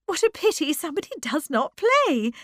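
high, loud excited exclamation; cried out. A narrator's reading. Bertha jumping to the piano, crying out excitedly. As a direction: burst out brightly. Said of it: "she cried".

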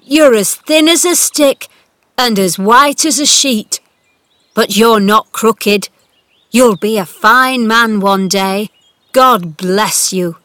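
loud, fervent, slowing blessing; choked with emotion. A narrator's reading. Gardener blessing the boy who stands, moved. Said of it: "He choked".

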